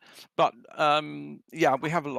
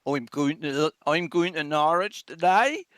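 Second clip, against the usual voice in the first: lilting Norfolk country accent, rising at the end; 3 semitones higher, 4 dB louder than usual. Shown as a demonstration. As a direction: imitate a rural Norfolk local, pitched up. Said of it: "how would they talk? They would say".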